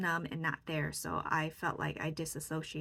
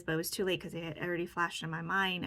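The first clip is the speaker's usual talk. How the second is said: ordinary delivery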